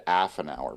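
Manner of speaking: "half" said as 'aff, with no H; dropped H. Retold or imitated. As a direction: quote "half" back with the H missing. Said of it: "'cause you said".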